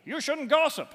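high, loud mock moralizing voice; sing-song scolding like a nagging parent; mock preachy scold. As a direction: wag a finger and scold the room. Quoting moral rules in preachy voice.

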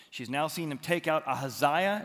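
ordinary delivery

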